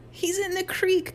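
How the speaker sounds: high, slow singsong crow. A narrator's reading. Mike crows over Harold's fall, gleefully. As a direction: gloat in a high, drawn-out call. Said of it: "crowed Mike".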